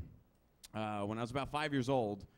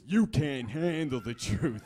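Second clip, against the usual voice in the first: slow, loud courtroom outburst; hammed-up dramatic showdown; movie-quote impression; 8 dB louder, slower than usual. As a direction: thunder it out word by word.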